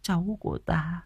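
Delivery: high, very soft lament; soft, sorrowful and high; tearful tenderness. A narrator's reading. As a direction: murmur it tenderly, close to tears. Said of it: "rưng rưng nước mắt".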